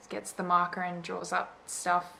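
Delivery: ordinary delivery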